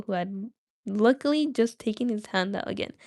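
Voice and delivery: plain reading voice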